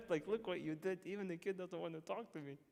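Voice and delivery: half-laughing reproach to the wife; mock accusing thought. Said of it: "I'm thinking".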